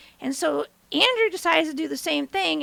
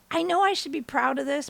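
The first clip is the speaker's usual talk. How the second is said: normal talking voice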